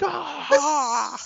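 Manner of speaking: high mock roar; imitating the friend's war cry; Hulk roar. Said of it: "he's like".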